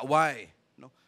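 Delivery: ordinary talk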